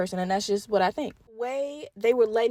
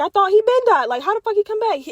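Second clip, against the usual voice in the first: incredulous; 9 semitones higher, 3 dB louder than usual. Retold or imitated. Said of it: "I was like".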